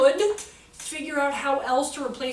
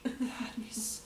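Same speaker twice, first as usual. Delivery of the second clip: unvoiced, hushed aside; fast, embarrassed whisper; 12 dB quieter than usual. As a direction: whisper it, mortified.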